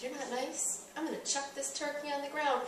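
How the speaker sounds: quietly taunting her husband; menacing threat. Retold or imitated. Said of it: "I was like".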